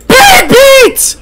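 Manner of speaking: very high, loud scream; piercing gamer callout; excited callout. Real, not acted. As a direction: shriek the play to your team. Player calling out a play.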